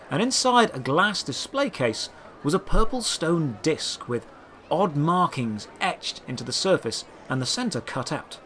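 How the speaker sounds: usual speaking voice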